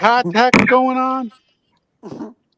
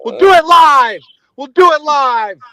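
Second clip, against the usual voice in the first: high, loud hammed-up yell; mock meltdown; 5 semitones higher, 5 dB louder than usual. Caller parodying an on-air meltdown, loud mock rage. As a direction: yell it in exasperated outburst.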